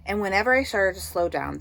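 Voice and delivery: ordinary delivery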